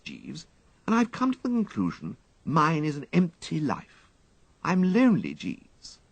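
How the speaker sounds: confiding his loneliness to the valet; lightly plaintive upper-class voice; composed but wistful toff. A narrator's reading. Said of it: "regaining a certain degree of composure".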